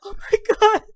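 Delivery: high, tearful cry over the dog; choked up. Acted. Ren hugging his long-lost dog, tearful and high. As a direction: let the voice break with joy and grief. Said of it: "he is full on sobbing".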